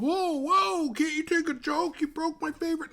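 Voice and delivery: very high, whiny mock voice; mock the bully's outrage in a squeaky falsetto; whiny appalled bully. Mocking a bully who gets out-dicked, high whiny falsetto. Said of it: "They're like".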